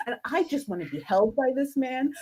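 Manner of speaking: normal talking voice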